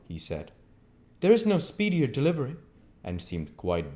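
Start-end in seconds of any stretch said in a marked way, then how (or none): 1.2-2.6 raised, clipped-sounding stranger; a lightly voiced curt stranger; a hint of the stranger; stranger's voice. A narrator's reading. Visitor voiced.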